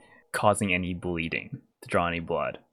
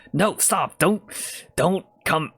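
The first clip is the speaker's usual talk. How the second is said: urgent stop; 7 semitones higher, slower than usual. Acted. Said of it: "then the Yuan-Ti goes".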